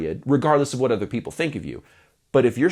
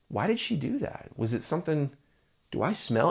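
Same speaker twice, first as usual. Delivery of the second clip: soft, high, self-doubting murmur; insecure mumble; 3 semitones higher, 10 dB quieter than usual. Retold or imitated. Artist muttering worries after a rejection, softly. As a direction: wonder it aloud under your breath.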